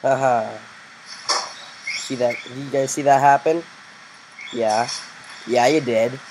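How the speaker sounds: ordinary talk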